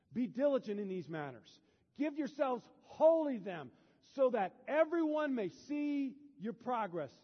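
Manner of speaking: slow, loud, high proclaiming of the verse; loud scripture reading. Acted. Pastor proclaiming scripture to the church, loud and slow. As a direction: proclaim the verse slowly to the room.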